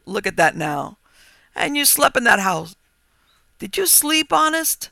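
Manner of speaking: loud, climbing into high disbelief; exclaiming. A narrator's reading. Farmer amazed anyone slept in that house, exclaiming. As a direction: marvel at it, pitch rising as you go. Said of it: "he exclaimed".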